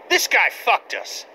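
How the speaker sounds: normal talking voice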